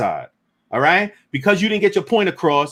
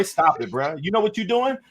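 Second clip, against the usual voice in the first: ordinary talk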